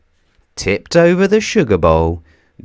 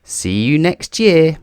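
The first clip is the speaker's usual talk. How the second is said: higher, a bit louder call across the street; 8 semitones higher, 4 dB louder than usual. A narrator's reading. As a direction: keep calling after her. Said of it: "called back Mister Bump".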